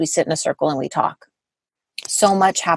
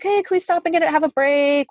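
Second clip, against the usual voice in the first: put-on high, pleading kid voice for hiking sons; imitating tired kids begging to stop; mock kid voice asking for a rest; kids asking for a break; 10 semitones higher than usual. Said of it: "They're like".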